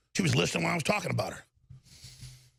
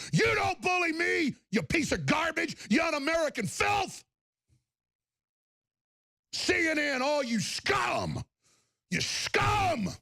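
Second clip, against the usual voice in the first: higher, yelling insults; host yelling at a bully, furious shout; 7 semitones higher, slower than usual. Real, not acted. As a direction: blast it with real rage. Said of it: "direct furious address".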